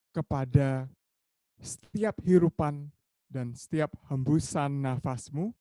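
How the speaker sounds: slow meditation voice with drawn-out words; guided meditation. Acted. Teacher guiding friends to feel their bodies, slow guided meditation. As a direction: guide them gradually into body awareness, slow and lifted, with long pauses.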